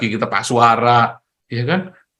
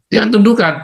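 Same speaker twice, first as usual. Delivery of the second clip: loud warning; 9 semitones higher, 5 dB louder, faster than usual. Real, not acted. Preacher warns of the devil.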